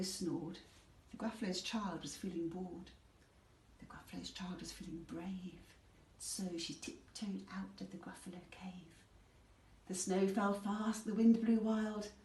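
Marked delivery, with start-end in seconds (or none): 3.8-9.0 hushed, secretive narration; whisper. A narrator's reading. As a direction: whisper as if sneaking out. Tiptoeing out, whispered.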